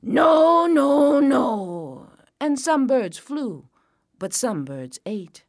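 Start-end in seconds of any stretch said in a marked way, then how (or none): 0.0-2.1 loud, high holler; long shouted no's, falling off; calling out. A narrator's reading. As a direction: shoo the birds with a yell. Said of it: "He called out".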